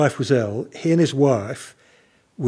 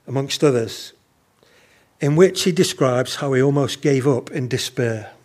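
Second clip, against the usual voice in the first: ordinary talk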